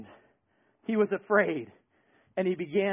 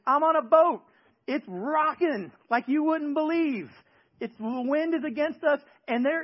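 higher, louder, mock exasperation; incredulous protest; 7 semitones higher, 4 dB louder than usual. Preacher to Jesus, mock exasperated protest. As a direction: argue it like he's gone mad.

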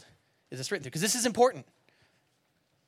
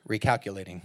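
lower, with a laugh breaking through; laughing GPS voice; 10 semitones lower, faster than usual. Pretend. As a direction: chuckle through a robotic word.

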